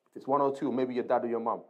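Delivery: usual speaking voice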